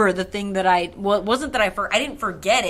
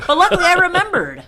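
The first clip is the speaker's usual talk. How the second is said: triumphant; 9 semitones higher, 4 dB louder than usual. Real, not acted. Triumphant punchline.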